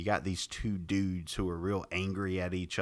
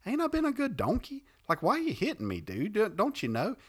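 high, put-upon donkey pleading with his master; high whiny donkey voice; talking donkey; 13 semitones higher than usual. Retold or imitated. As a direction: squeak it out like a wounded pet donkey. Donkey talking back to Balaam.

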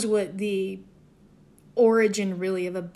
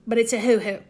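amused emphasis; 4 dB louder, faster than usual. Shown as a demonstration. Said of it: "I don't know why".

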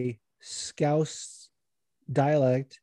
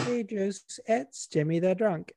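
lightly voiced girlfriend, higher; a hint of a woman's voice; mock girlfriend greeting; imitating his girl; 6 semitones higher than usual. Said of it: "like, his girl".

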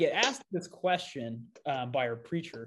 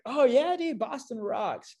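high-pitched put-on excitement; feigned enthusiasm; 9 semitones higher, slower than usual. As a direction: play along as an eager fan, voice pitched way up. Retelling his own feigned enthusiasm.

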